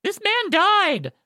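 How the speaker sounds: high, stretched-out shriek of shock; losing it. Retold or imitated. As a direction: re-enact a freaked-out phone rant, very high and drawn out. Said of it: "losing it".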